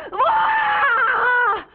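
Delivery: loud tearful cry of frustration; teen breaks into a tearful yell; teary shout. Acted. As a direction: shout it out near tears. Frustrated outburst.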